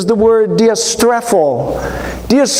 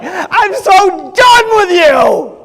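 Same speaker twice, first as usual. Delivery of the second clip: high, slow, loud fed-up outburst; mock shouted outburst; exasperated; 10 semitones higher, 3 dB louder, much slower than usual. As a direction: done with them entirely. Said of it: "Jesus is like".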